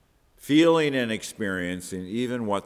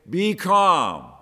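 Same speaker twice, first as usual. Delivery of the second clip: loud, higher-pitched firm order; commanding the elements; 7 semitones higher, 7 dB louder than usual. Acted. Jesus to the wind and the waves, loud raised command. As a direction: command it with full authority. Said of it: "speaking directly to the wind and to the waves".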